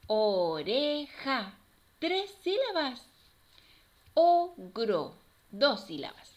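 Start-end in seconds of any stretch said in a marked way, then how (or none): 0.1-1.5 slow "O-re-ja" in three beats; teacher counting out each syllable of "Oreja". Shown as a demonstration. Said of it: "vamos a separar cada una". 4.1-5.2 loud "O-gro" in two beats; teacher segmenting "Ogro" for children; syllable by syllable. Shown as a demonstration. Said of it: "vamos a separar cada una".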